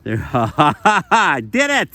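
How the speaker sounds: laughing, voice jumping up in triumph; triumphant laugh. Real, not acted. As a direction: cackle with joy as the flame catches. Finally lights the fire.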